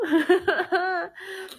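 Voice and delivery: teary laugh; laugh through tears. Real, not acted. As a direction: laugh while half crying. Laughing while crying.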